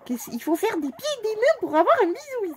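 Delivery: hammed-up relieved whine; high mock-exasperated pet talk. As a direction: tease the pet fondly. Mock exasperation, kiss finally given.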